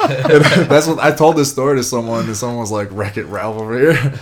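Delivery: can't keep the laugh down; amused laugh as he tells it. Real, not acted. Laughing while retelling.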